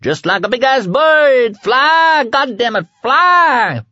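very high screamed urging; screaming. A narrator's reading. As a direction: yell wildly, peaking on each "fly". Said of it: "the kid screamed".

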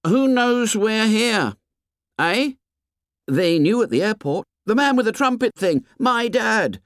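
high, put-on boys' voices; insistent boys. A narrator's reading. Piggy asks who knows they are here, high and insistent.

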